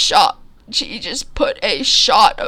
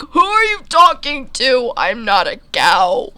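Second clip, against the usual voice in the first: high, louder indignant squeak; 5 semitones higher, 5 dB louder than usual. Retold or imitated. Narrator insisting to the farmer he is a whale, squeaky. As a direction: squeak it out, outraged at being called a cow.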